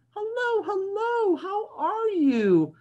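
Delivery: very high, sing-song hello; high pigeon voice greeting his duck; pigeon greets duck. Acted. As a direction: greet the rubber duck sweetly. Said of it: "he says".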